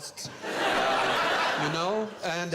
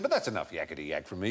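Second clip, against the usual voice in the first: airline announcer; 8 semitones lower, faster than usual. Retold or imitated. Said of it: "He said".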